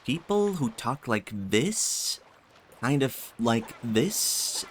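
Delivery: slow drawl with THIS swooping up at the end; stretched-out words, rising sharply on THIS; uptalk imitation. Shown as a demonstration. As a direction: imitate uptalkers, lifting each THIS like a question. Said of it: "With an uptone like that".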